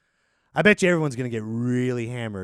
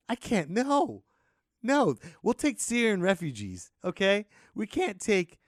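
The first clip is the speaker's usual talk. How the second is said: mock refusal; 7 semitones higher, slower than usual. Acted Canadians turning Americans away.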